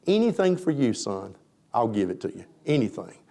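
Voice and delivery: soft, loving king to his son; a father's quiet tenderness; tender father. Acted. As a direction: give it gently, like a doting father.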